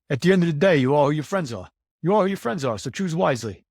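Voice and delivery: put-on proud son doing his dad's North Jersey accent. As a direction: quote your father's saying in his North Jersey accent. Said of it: "I'll say it in his North Jersey accent".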